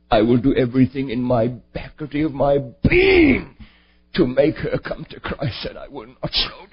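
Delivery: low resolute vow to keep going; stubborn father's vow. Retold or imitated. Father answering his son about Granny, low and resolute.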